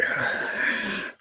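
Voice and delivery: long breathy sigh of exasperation; acted-out sigh of giving up; resigned sigh. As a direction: let out a heavy, defeated sigh. Acted reaction to girlfriend's refusal.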